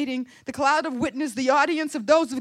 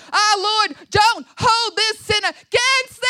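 high, loud shout with the last word held very long; echoing trumpet cry; 11 semitones higher, 9 dB louder than usual. Acted. Stephen's prayer echoes through time and space, long-held shout. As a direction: cry it out and sustain the final word. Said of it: "is now echoing through time and space".

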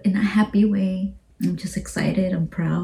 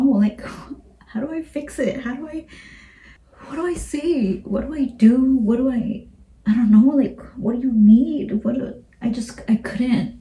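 higher, breathless pleading questions; panicked helplessness; 5 semitones higher than usual. Retold or imitated. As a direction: ask desperately, voice pitched up and strained. Retelling panic over her daughter.

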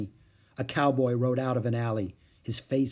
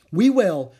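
put-on loud, higher girl's promise; eager child, louder and pitched up; 6 semitones higher, 10 dB louder than usual. A narrator's reading. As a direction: burst in with keen agreement. Girl eagerly agreeing.

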